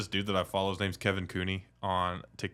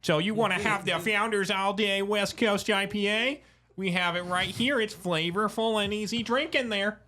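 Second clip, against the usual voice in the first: high, singsong Michigan accent; broad put-on Michigan accent, pitched way up; 11 semitones higher than usual. Shown as a demonstration. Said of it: "this is how you talk".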